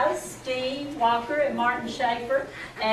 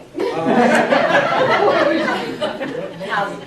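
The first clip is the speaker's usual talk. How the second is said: hearty laugh; 5 semitones higher, 8 dB louder than usual. Real, not acted. Long burst of laughter.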